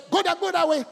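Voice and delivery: high, loud mock instruction; pointing out directions. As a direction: bark it like a guide waving you off. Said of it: "if I just tell".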